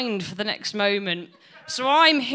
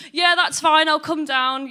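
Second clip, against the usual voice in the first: higher, louder mock-chirpy agreement; fake cheerful; 6 semitones higher, 6 dB louder than usual. Son answering mum on the phone, fake cheerful. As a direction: put on fake brightness. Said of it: "I was like".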